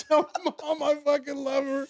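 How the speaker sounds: high, hammed-up last words through laughter; laughing mock farewell. Telling sister his last words for mom, laughing mock plea. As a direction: act a deathbed goodbye while cracking up.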